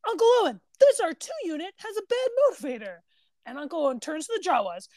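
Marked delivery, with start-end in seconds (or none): 0.1-3.0 lightly acted movie quote, pitched up; whiny young Luke. Luke calling out to Uncle Owen about the droid, lightly acted whine. Quoting Luke's film line.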